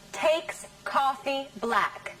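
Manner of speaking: just the stressed words, spaced out; stressed words only. Shown as a demonstration. Teacher repeats only the stressed words, slowly. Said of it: "Which words are stressed".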